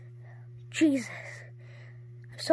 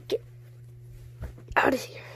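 usual speaking voice

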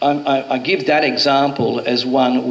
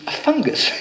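amused disbelief. Retold or imitated. Incredulous echo of client's food.

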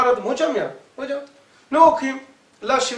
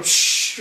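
fizz sound. Retold or imitated. Imitating fizzing tablet.

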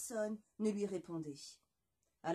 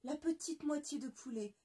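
ordinary delivery, nothing marked

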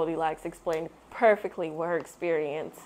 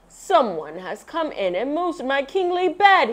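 Papa Bear cry; 7 semitones higher, 5 dB louder than usual. A narrator's reading. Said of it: "he cried".

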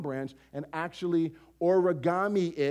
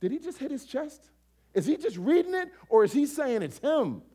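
astonished crowd; 7 semitones higher than usual. Retold or imitated.

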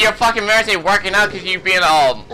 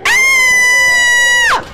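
sudden high shriek of pain; pained scream; 5 dB louder than usual. Real, not acted. Player screaming when the enemy stabs him. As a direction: scream out as the blade hits. Jumped by a stalker in game.

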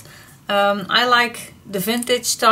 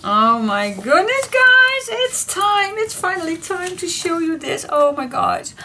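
high, squealing thrill at a reveal; real excitement; 9 semitones higher, 3 dB louder than usual. Real, not acted. Crafter finally revealing her project to viewers, thrilled. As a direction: burst upward with giddy anticipation.

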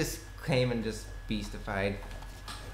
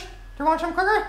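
much higher and fast, syrupy offer; sweet granny voice; 6 dB louder, faster than usual. Pretend. Gamer offering enemies cookies in a sugary high voice. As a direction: sweetly tempt them like a doting grandma.

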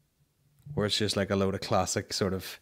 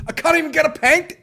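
mimicking the man in the clip; 11 dB louder than usual. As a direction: squeal it out like the fed-up fella in the video. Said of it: "I think he says".

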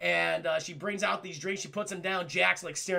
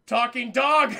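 loud, startled disbelief; comic panic; 3 semitones higher, 9 dB louder, slower than usual. Acted. Jack looking down at a talking dog, comically panicked. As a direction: blurt it loudly in mock panic. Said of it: "I love his panic".

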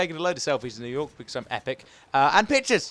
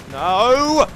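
high, loud no; shouting at computer; 6 semitones higher, 9 dB louder than usual. Real, not acted. Gamer to the computer, frustrated shout. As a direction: yell in frustration at the game. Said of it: "hence why I'm shouting at the computer".

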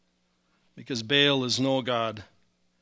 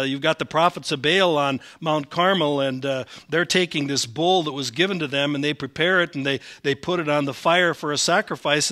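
usual speaking voice

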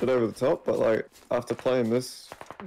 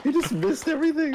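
incredulous exclaim; 3 dB louder than usual. Real, not acted. Laughing disbelief at enemy.

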